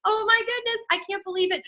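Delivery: high, mock-amazed gush; acted amazed relief. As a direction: imitate a friend's high, disbelieving relief. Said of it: "Jenna's gonna say to Cassidy".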